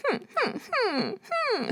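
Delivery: high, soft crying whimpers; like a puppy stuck in a closet. Shown as a demonstration. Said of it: "a puppy stuck in a closet".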